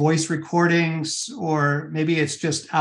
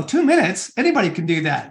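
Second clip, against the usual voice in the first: scoffing skeptic; faster than usual. Retold or imitated. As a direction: play the doubter who thinks it's too easy. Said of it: "you go".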